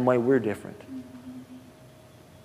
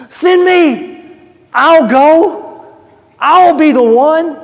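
much higher, loud, eager cry; eager volunteering; 12 dB louder, much slower than usual. Acted. Isaiah answering God, high eager cry. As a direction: leap forward to volunteer.

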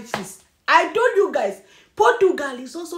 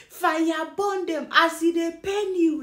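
ordinary delivery

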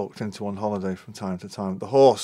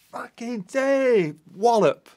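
mock exclaim; 11 semitones higher, 3 dB louder, much slower than usual. Retold old tradition exclamation.

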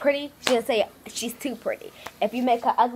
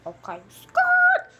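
very high, loud, drawn-out catchphrase; squealed comeback; 5 dB louder, much slower than usual. Acted. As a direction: screech it out, long and high. Acted comeback behind the poles.

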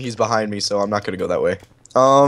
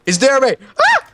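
high-pitched, rising alarm; panicked alert; 8 semitones higher, 4 dB louder than usual. Real, not acted. Player warns the hunter is near, high panicked alert. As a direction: blurt it in climbing panic.

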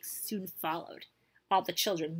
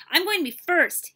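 higher, louder, eager burst; eager child's claim; 9 semitones higher, 8 dB louder than usual. A narrator's reading. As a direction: blurt it out eagerly. Eager boy claims first turn.

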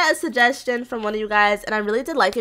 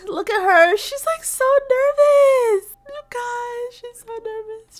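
nervous cooing; 11 semitones higher than usual. Real, not acted. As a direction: squeal with nerves for her, then shrink. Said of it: "I'm so nervous for her".

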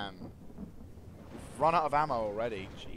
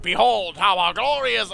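hammed-up herald; 5 semitones higher, 13 dB louder than usual. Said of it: "it's like".

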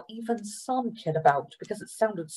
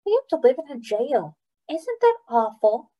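put-on higher little girl voice; 6 semitones higher than usual. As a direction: sound young and wide-eyed. Little sister Ploy speaks.

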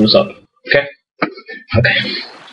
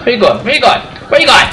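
rising taunt; 9 semitones higher, faster than usual. Acted. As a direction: taunt rising higher each time. Taunting a game enemy.

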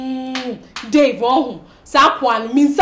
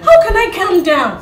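exasperated, raised-voice retort; agitated protest; 4 semitones higher, 4 dB louder than usual. Acted. Upset woman refusing to calm down, exasperated. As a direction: throw the question back, high and worked up. Rejects friend's plea to calm down.

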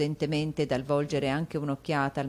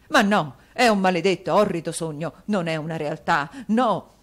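louder, overwrought denial; theatrical husband in despair; 7 dB louder than usual. A narrator's reading. Husband calling it a dream, melodramatic despair. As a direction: refuse to believe it.

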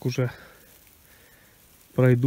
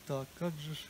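ordinary talk

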